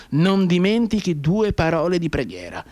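stern officer interrupting; insistent colonel. Acted. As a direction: lean on each word.

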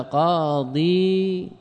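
قاضيون stretched slowly into "qaa-dii-uun"; slow, long-vowelled قاضيون; underlying form. Shown as a demonstration. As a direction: draw out each syllable of قاضيون, keeping the ya. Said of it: "هذا الأصل".

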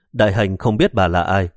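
plain reading voice

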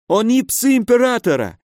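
high-pitched, frightened protest; panicked insistence. A narrator's reading. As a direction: insist desperately in a high pained voice. Injured baron pleads under threat. (испуганно, высоким умоляющим голосом)

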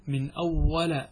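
"أول" ending in a short a; fatha on the lam. Shown as a demonstration. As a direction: say "أول" as awwala. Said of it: "بضم اللام وفتحها وكسرها".

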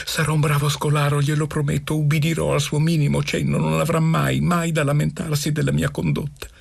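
rushed, high-pitched promises; great haste. A narrator's reading. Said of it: "aggiunsi in gran fretta".

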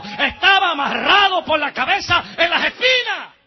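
loud, high, driving sermon voice; full-force preaching. Acted. El predicador enardece a la congregación, gritando fuerte. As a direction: preach it out, building to shouts.

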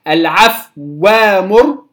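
العفو وامر with silent فاء then doubled واو, slow; correct reading. Shown as a demonstration. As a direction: hold the fa still then stress the waw. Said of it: "أسكن الفاء الأول". (قراءة صحيحة بتسكين الفاء)

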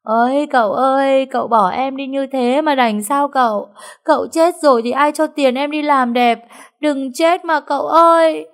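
high, loud hammed-up lament; over-the-top mock grief, loud and high; funeral wailing. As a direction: wail like a mourner at a funeral. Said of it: "Miệng nó than khóc không khác gì đưa đám".

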